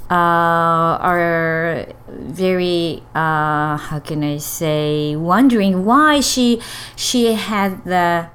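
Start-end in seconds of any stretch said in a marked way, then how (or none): none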